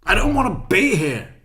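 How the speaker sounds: rising from firm to a near shout; building exasperated outburst. Real, not acted. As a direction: frustration boiling over into a yell. Ranting about unwanted visits.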